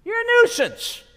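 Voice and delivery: high, loud contemptuous shout; crowd shouting him down. Acted. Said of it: "all these people telling him".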